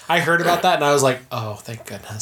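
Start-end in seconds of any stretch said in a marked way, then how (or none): 1.3-2.2 low, very soft sigh of relief; retold relief. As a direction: let it out softly, acting relieved. Retelling own relief at the news.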